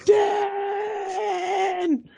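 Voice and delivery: high, cried-out scream of a name. Retold or imitated. Bitten boy screaming for Dan, high and crying. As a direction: shriek the name in pain and fright. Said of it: "I've, like, screamed".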